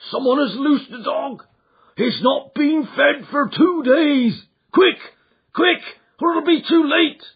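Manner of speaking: put-on the old servant crying frantic warnings; panicked cry. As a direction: beg them to hurry. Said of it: "he cried".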